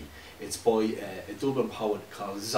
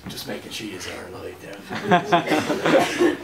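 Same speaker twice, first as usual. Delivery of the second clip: laughing; 6 semitones higher, 5 dB louder, slower than usual. Real, not acted. Poet teasing his silent audience, laughing. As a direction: chuckle it out, poking fun at the silence.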